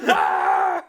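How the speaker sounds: short, loud, low yell; loud comic "Ah!" yelled out; mock scream. Mock scream after joke about escaping.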